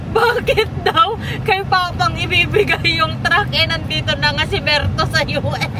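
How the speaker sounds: high, helpless giggles through the words; laughing while talking. Real, not acted. Retelling Daddy's dream of quarrelling over a truck, laughing. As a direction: tell it through fits of laughter.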